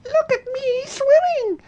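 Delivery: very high squeaky voice; a proud little joey squeaking; squeaked. A narrator's reading. Little Roo shows off in the water, very high squeak. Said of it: "was answering".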